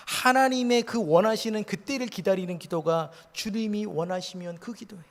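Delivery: ordinary talk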